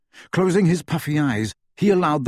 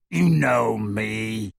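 slow, resentful werewolf growl; much slower than usual. A narrator's reading. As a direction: sullen beast, stung. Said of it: "There was resentment in the werewolf's voice".

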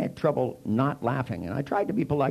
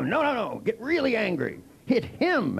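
egging him on; 9 semitones higher than usual. Retold or imitated. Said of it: "They go".

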